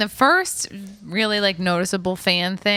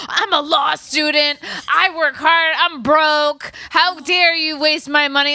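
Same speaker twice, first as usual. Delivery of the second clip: higher, louder indignant rant; angry; 7 semitones higher, 3 dB louder, slower than usual. Retold or imitated. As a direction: rant like a wronged student. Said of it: "He got so mad".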